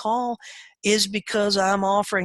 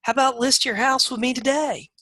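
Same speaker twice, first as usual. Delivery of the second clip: bad agent call; 7 semitones higher than usual. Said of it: "they say".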